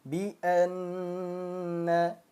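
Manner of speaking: Bi anna with the nn held and hummed for two beats; held nasal gunnah. Shown as a demonstration. Teacher demonstrating gunnah on nun bertasydid. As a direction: hum the nn of anna long. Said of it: "Ditahan dua harokat".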